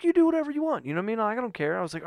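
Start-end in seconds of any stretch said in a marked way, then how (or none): 0.0-0.9 much higher-pitched mock wife voice; wife's dismissal. Wife tells fretting husband to suit himself, high-pitched dismissal. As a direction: imitate her waving him off, pitched way up. Wife waves off his pleading.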